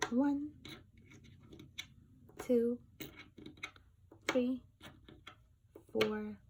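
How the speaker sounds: bright teacher counting, one by one; counting aloud. Shown as a demonstration. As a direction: count each object out for the class. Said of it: "teacher counting objects aloud".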